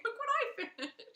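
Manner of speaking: high-pitched mock show-off voice; imitating showing off. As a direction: pipe up high, imitating a knitter showing finished work. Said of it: "she's just gonna be pulling".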